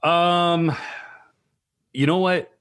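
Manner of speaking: normal talking voice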